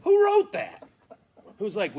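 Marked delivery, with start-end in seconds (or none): 0.0-0.9 exasperated outcry. Real, not acted.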